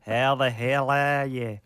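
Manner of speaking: hammed-up fake Aussie drawl; bad Australian accent. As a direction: stretch it out in a terrible Australian accent. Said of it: "you have the worst".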